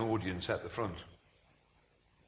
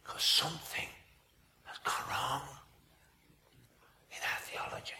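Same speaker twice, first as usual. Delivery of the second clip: hushed gravity; 9 dB quieter than usual. Real, not acted. Preacher confiding a fault to his congregation, hushed. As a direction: drop almost to a whisper. Hushed conclusion to the rally illustration.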